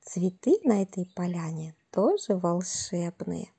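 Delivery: usual speaking voice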